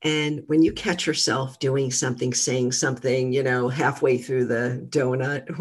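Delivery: ordinary talk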